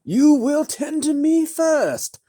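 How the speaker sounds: high, loud, slow imperious demand; sour haughty lady. A narrator's reading. Spoiled lady demanding the wizard treat her first, sour. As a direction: command it as a pampered noble. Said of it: "came a sour voice".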